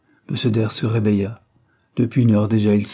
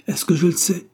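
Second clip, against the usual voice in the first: irritated retort; 8 semitones higher than usual. A narrator's reading. Embarrassed Anthony snaps back.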